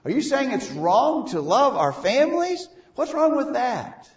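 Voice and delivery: indignant, high-pitched disbelief; shocked reaction. Acted. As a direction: play a congregant taken aback, voice jumping up. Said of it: "would draw a reaction from you".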